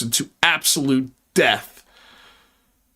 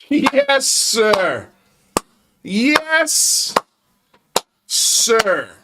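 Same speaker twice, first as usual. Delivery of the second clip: mock salute; 6 semitones higher, much slower than usual. Sarcastic reply to lawmaker.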